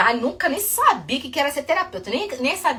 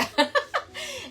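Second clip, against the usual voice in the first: short high burst of laughter at herself; laughing; 6 dB quieter than usual. Real, not acted. After forgetting a word, she laughs at herself. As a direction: laugh it off, embarrassed.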